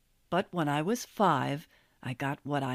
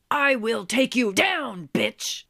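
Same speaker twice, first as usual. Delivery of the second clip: high, loud snarl of a furious monkey, ending in a hiss; angry monkey voice; 5 semitones higher, 6 dB louder than usual. Retold or imitated. Jocko's glare voiced as a raging snarl at the narrator. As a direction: voice the chimp's silent threat as a raging shriek. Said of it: "with an expression that screamed".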